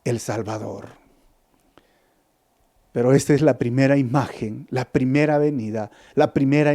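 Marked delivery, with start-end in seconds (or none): 0.0-0.9 softer, lower, slow announcement; calm reverent proclamation; gentle peace. Retold or imitated. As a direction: speak it slowly as a gentle promise. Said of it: "Para decir".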